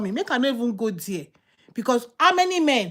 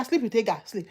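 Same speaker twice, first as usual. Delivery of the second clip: usual speaking voice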